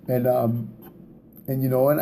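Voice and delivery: normal talking voice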